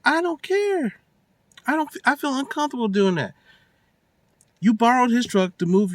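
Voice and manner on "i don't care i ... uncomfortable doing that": high mock whine; lightly voiced sulky man; whiny boyfriend. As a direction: play him as a petulant boyfriend. Voicing the boyfriend's protest.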